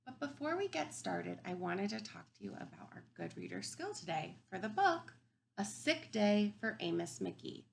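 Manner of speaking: normal talking voice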